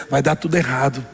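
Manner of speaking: a low, fretful inner voice of doubt; voice of the doubting mind. Retold or imitated. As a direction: play the anxious inner doubter, low and uneasy. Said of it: "a mente dizendo".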